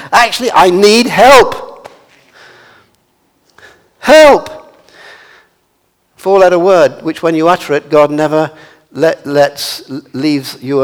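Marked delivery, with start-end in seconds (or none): 0.1-4.7 "help" drawn out loud, then cried alone; "help" rung out as an emphatic cry; stress on HELP. Shown as a demonstration. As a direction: hit "help" hard and loud. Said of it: "put the emphasis on helper".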